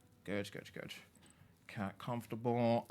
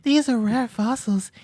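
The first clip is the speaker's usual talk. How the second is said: hooting owl curator, very high and loud; delighted fossil expert with owlish hoots and laughs; Blathers Jackson; 14 semitones higher, 13 dB louder than usual. Acted. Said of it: "bring Blathers Jackson back".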